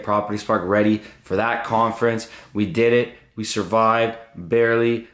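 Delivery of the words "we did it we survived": normal talking voice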